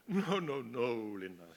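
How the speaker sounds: acted blowhard, full of himself, overriding her; blowhard dismissal. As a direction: wave her off like a pompous know-it-all. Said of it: "he's, he's a blowhard".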